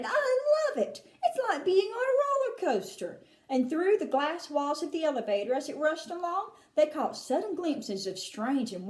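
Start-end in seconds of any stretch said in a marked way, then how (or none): 0.0-3.2 high laughing cheer; a boy loving the ride; high gleeful shout with a laugh; delighted shout. Acted. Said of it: "Charlie shouted".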